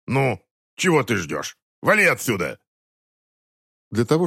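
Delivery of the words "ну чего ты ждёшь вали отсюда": gruff dismissal. Acted. Eric throws the detective out.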